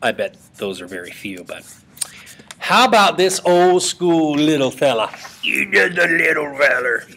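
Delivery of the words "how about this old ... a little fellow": Carl from Sling Blade. Retold or imitated. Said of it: "That is from the movie Sling Blade".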